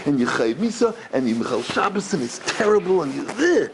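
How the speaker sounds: mildly hammed-up scolding, ending disgusted; policeman-style rebuke. Stern educator condemning a student, mock rebuke rising in pitch. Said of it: "That's one way to deal with it".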